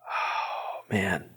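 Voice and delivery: usual speaking voice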